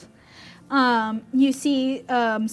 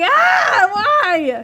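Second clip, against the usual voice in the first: very high loud wail; goblin wail; 9 semitones higher, 8 dB louder than usual. Acted. GM voicing goblins in panic, very high loud wail. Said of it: "It's just a lot of, like".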